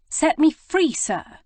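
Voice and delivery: high loud cry to be let go; indignant cry. A narrator's reading. Prudence pushing back the rake, high loud demand. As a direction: demand to be freed, sharply.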